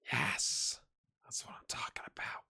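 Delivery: excited whisper under the breath. Real, not acted. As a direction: whisper the celebration in secret. Quiet celebration over a league title.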